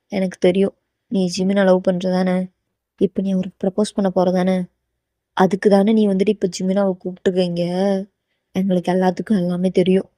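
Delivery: low, soft, knowing accusation; knowing confrontation. Acted. Girl confronting heroine about loving Jimin, coolly knowing. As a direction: keep it quiet and low, sure of the secret.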